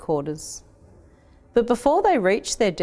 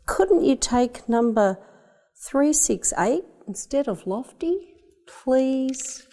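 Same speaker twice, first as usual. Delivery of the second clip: put-on higher, earnest child begging; pleading boy; 6 semitones higher, slower than usual. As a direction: plead with dad in a higher boy's voice. Boy begging father again.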